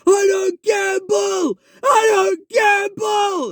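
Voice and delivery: high, drawn-out yell; imitated shouting. Beggar shouting back at the man who accused him of gambling, imitated shout. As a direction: stretch each word out in an outraged high-pitched shout. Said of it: "he was shouting".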